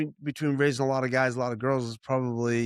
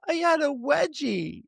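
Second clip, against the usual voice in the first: imitating a small daughter, much higher and slow; little girl's whine; 14 semitones higher, slower than usual. Daughter explaining herself to her dad, slow high whine. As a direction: whine it like a tearful five-year-old. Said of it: "She goes".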